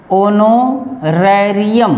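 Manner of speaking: ओनोरेरियम said slowly with no h sound; ओनोरेरियम, drop the h and start on o; silent h. Shown as a demonstration. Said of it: "इस ह को हम पढ़ेंगे ओनोरेरियम". (ह के बिना धीरे से ओनोरेरियम)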